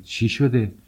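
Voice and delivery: startled, understated fright; alarmed question. A narrator's reading. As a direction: ask it in alarm. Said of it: "وحشت زده پرسید".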